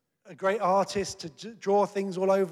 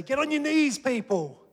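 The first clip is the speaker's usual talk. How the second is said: high, loud mock scolding; acted hectoring order; berating; 8 semitones higher, 6 dB louder than usual. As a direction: berate the congregation like a demanding preacher. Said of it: "I can berate you and say".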